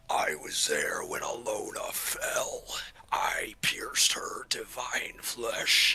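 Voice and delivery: slow, whispered guttural menace. Acted. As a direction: rasp it out slowly like an ancient evil. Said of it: "a very guttural sounding voice that says".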